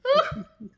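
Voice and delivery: sudden squeal of laughter; high-pitched burst of giggles. Real, not acted. Cracking up at own story.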